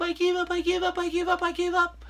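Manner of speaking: soft, lightly voiced whimpering give-up; mock surrender. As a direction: quietly beg to tap out. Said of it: "to verbally tap out".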